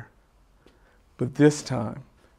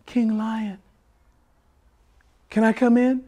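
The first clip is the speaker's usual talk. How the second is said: high-pitched monkey voice; timid monkey calling in; 8 semitones higher than usual. Acted. Monkey asks the crying king to let him in, high tentative voice. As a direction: call in high, asking permission.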